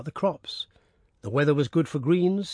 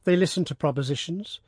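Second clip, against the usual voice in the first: usual speaking voice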